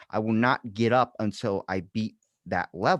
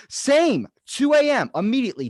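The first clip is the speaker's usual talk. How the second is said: high, loud excited burst; excited shout; 7 dB louder than usual. Retold or imitated. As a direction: yell it like a thrilling discovery. Excited recognition, retold to grandfather.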